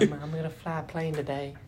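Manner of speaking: high-pitched mock boast; mocking show-off. Mocking someone bragging about a flight, high put-on boast.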